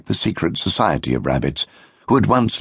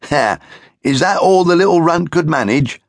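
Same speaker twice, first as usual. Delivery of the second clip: high, loud scornful jeer; mock the little runt from above; scornful big brother; 11 semitones higher, 4 dB louder than usual. Big brother sneering at the runt, high and loud. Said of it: "cried his biggest brother, Maximilian, scornfully".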